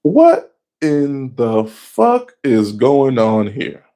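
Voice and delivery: drawn-out, slow stunned question; slow disbelief. Retold or imitated. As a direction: stretch every word in shock. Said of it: "I'm like".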